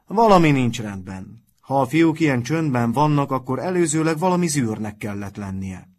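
booming, suspicious announcement; speaking loudly. A narrator's reading. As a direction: address the whole dormitory loudly. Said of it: "mondta hangosan".

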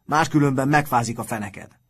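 put-on loud boyish taunt; shouted warning. A narrator's reading. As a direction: call it out to a roommate. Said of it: "kiáltott az egyik fiú".

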